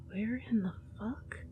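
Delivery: breathy hushed curse; a muttered whispered curse; low whisper. Acted. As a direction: whisper it to yourself, barely audible. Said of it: "you lowly trace".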